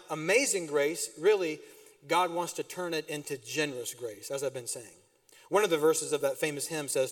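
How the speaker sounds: ordinary talk, nothing marked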